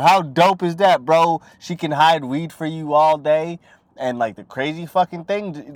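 ordinary talk

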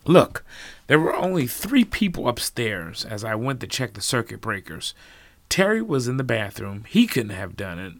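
heated accusation. Acted. Norman accuses Lisa.

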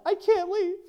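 high, small kindergartner's plea; little girl voice. Retold or imitated. Sally answers the teacher from the floor, high and small.

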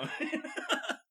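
real high-pitched chuckle; high laugh. Real, not acted. Laughing at the story.

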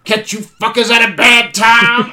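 loud, high, drawn-out one-liner; big imitation of a movie catchphrase; Whistler's delivery. Shown as a demonstration. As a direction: belt it out slowly like an action-film rescuer. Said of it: "in his delivery".

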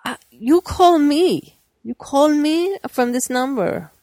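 annoyed caller. Retold or imitated. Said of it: "Who's this?".

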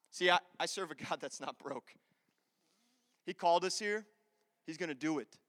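ordinary talk, nothing marked

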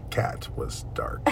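low, slow hushed whisper; spooky whisper. Pretend. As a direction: whisper it like a ghost story. Spooky book description, whisper.

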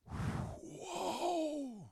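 high, breathless gasp of wonder; a stunned, high-pitched whoa; awed whoa. Retold or imitated. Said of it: "and I just went".

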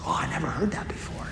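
breathy hushed whisper, quick and amazed. Retold or imitated. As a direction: whisper it like a stunned secret. Retold plane chat, man amazed.

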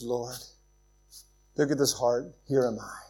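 quiet yielding prayer; humble hushed reply. Acted. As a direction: answer God in a humble murmur. Samuel answers God humbly.

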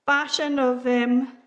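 ordinary talk

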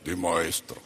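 mock-holy Pharisee answer; Pharisee's pious voice. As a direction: imitate a smug religious man. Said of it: "¿Cómo dijo el fariseo?".

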